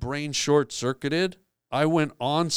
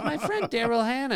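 much higher, excited mock Lovitz bragging; Jon Lovitz impression; 9 semitones higher than usual. Lovitz in the wings bragging about his club, high mock voice. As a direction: pitch it way up like a proud club owner.

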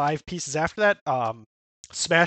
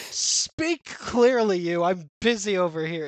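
curt mom; 2 semitones higher, slower than usual. Retold or imitated. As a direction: drag out each word like a bored, bossy mother. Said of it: "and she's just like".

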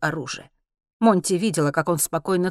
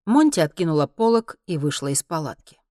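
ordinary delivery, nothing marked

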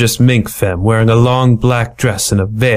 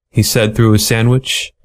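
ordinary delivery

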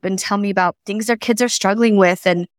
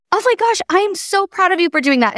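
high-pitched, gushing praise; gushing proud parent; 9 semitones higher than usual. Retold or imitated. Parent cheering on a teen who persevered, gushing. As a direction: gush with pride, starting way up high.

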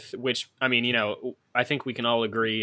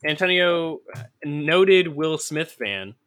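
slow, higher, louder host intro; announcer voice; 5 semitones higher, 4 dB louder, slower than usual. Pretend. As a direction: introduce him like a show host.

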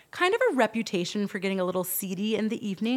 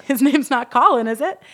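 higher, louder teasing question; joking; 4 semitones higher, 10 dB louder than usual. Retold or imitated. Client to girlfriend with the same handbag, joking. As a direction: toss it out as a mock-casual joke. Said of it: "my client jokingly, she says".

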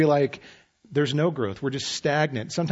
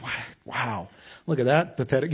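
mock pity. Others judging you.

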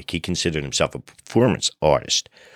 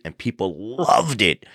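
ordinary delivery

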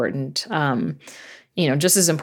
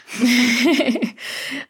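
high bashful laugh; 9 semitones higher than usual. Real, not acted. Laughs at her own bold wish.

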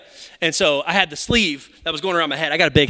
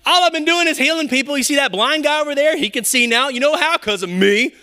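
mock boastful Jesus; 7 semitones higher than usual. Preacher mocking a boastful Jesus, high and bragging. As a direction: puff up and brag like you own the room. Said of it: "He could have said".

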